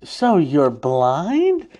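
very slow, drawn-out question; stage Aang asking Toph, very slow with a rise; acting Aang. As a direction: stretch it out like a clueless actor. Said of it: "Aang is like".

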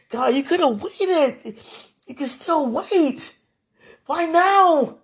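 slow, rising, tearful plea; tearful pleading. Real, not acted. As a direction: beg through tears. Pleading with God, crying.

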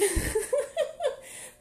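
bright burst of high laughter. Real, not acted. Laughing at being last passenger.

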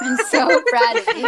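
high, loud laughing "I'm so proud of you"; laughing praise. Real, not acted. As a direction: gush it out while laughing.

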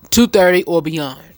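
ordinary talk, nothing marked

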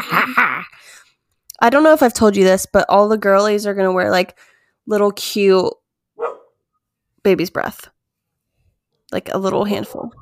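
usual speaking voice

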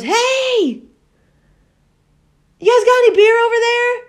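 high, loud imitation of hailing a distant boat; calling out. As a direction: holler across the water as the grandpa.

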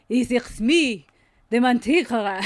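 mock French accent for the big reveal. The manticore announcing itself in a hint of a French accent. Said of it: "con acento francés".

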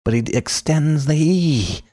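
ordinary delivery

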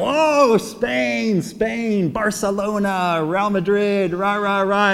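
high, delighted mock cheer; happy cheering. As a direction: rejoice like football fans, drawn out. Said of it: "they're all happy and everything".

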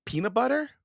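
high, questioning offer; tentative offer. A narrator's reading. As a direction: hold it out as a high, hopeful question. Boy offers food to warrior.

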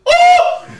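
sudden high, very loud yelp; imitated scream. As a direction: scream out like a kid burning his hand. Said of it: "Sorry for yelling out loud".

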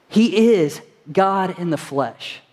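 slow, each word weighed; slow emphatic. Real, not acted. Preacher declares to congregation slowly, with weight. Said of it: "slow emphatic declaration".

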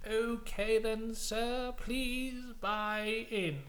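high, very slow formal British dealer; put-on professional British voice; Chegwin. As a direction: draw out each word like an affected croupier. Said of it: "he's put on the voice to sound more professional".

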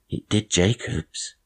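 sly, slowed aside. A narrator's reading. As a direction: slip it in slyly, taking your time.

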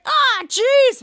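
high, loud mock disgust; acted-out yelp of revulsion. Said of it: "they were disgusted".

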